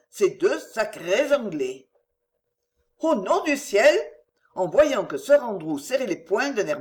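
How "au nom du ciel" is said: higher and louder, alarmed lady; startled noblewoman's exclamation, raised voice; alarmed outcry. Acted. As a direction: cry out to stop him. Marguerite alarmed.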